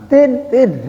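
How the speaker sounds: high double "tin" like a horn; car horn imitation. Preacher mimics teachers honking on the road. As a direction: beep twice like a car horn. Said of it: "Jarang tuh asal tengah jalan".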